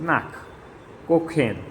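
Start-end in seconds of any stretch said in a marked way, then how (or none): 0.0-0.3 नाक said with a rough ch from the throat at the end; throaty ch sound. Shown as a demonstration. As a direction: end नाक with a scraping German ch from the back of the throat. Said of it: "गले में से sound निकलता है उस तरीके से".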